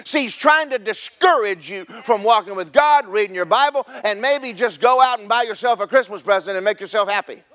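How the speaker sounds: high-pitched, driving sermon delivery; full-force preaching. Real, not acted. Preacher assuring congregation they are made righteous, impassioned high preaching. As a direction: preach it in rising, unbroken waves.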